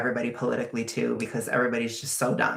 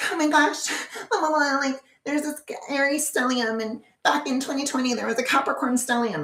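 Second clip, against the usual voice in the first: much higher, airy, fast gushing parody; mocking astrologers; 13 semitones higher than usual. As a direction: ham up their breathless excitement. Mocking astrologers' excited chatter.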